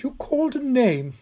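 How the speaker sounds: put-on high, urgent old lady; insistent old woman. A narrator's reading. Old woman pressing Tom about the name, high and insistent. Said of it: "she insisted".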